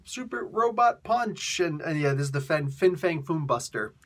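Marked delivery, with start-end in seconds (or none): 0.0-1.6 robot attack call. Retold or imitated. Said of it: "he's like, you know".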